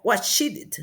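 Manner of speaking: WATCH IT slowly, as a mistaken two-syllable past; wrong -ed ending. Shown as a demonstration. Teacher showing the forbidden form, wrong -ed ending. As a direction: draw out WATCH and add "it". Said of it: "No podemos decir watch it".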